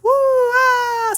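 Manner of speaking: very high loud cheer; real excited whoop; whoop of joy. Real, not acted. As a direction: let out a gleeful shriek. Celebrating finished experiment.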